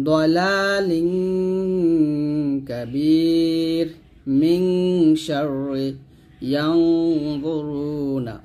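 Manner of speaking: long hummed nasal in "দলালিন", "মিং", "ইয়ানজুরুনা"; nasal ghunnah. Shown as a demonstration. Teacher showing learners the pronunciation, "দলালিন" with long held nasal. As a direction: recite slowly, stretching the n of "দলালিন". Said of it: "উচ্চারণটা এরকম হবে".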